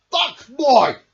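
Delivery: loud possessive shout; angry cry. Acted. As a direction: claim the book. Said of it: "cried Bear".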